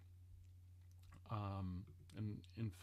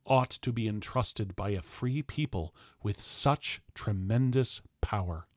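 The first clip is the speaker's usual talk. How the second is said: loud, declaiming nineteenth-century speech; booming political address with slow, weighty climaxes; formal oration; 5 semitones higher, 20 dB louder than usual. Acted. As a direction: thunder it from the podium like a statesman. Reads ancestor's speech as oration.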